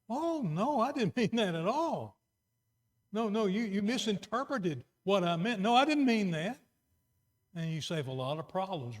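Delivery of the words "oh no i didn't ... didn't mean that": high-pitched acted protest; protesting spouse. Spouse correcting what was heard, acted protest. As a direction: hurry to correct them, voice lifted high.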